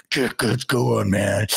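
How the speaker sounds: slow, breathy mock growl; heavy breathing. Imitating Mark Wahlberg's breathy line, heavy breathing. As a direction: puff out each word like the actor. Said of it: "where he breathes a lot".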